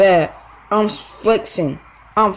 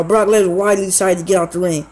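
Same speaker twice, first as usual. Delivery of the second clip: normal talking voice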